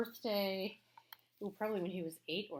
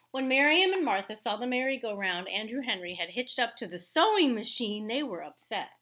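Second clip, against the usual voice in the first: louder, measured read-aloud voice; story narration; 4 semitones higher, 9 dB louder than usual. A narrator's reading. Teacher reading a picture book to her class, storytelling voice.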